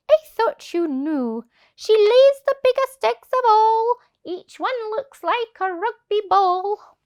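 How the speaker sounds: loud, high exaggerating voice; boastful goose. Goose tempting the thieves, loud and high. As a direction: puff up the tale grandly. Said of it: "The goose replied".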